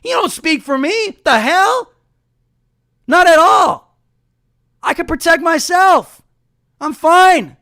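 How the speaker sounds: high-pitched outburst at the TV; indignant retort. Retold or imitated. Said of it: "I'm like".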